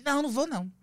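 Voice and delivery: a lightly voiced sulky refusal; mock refusal.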